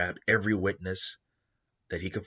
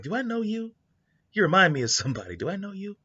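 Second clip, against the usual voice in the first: put-on high, puzzled voice of a young man; the son, much higher and searching; 12 semitones higher, 3 dB louder, faster than usual. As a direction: squint up at a stranger who seems familiar. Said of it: "it's like".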